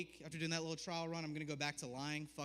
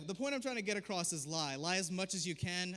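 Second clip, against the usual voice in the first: ordinary talk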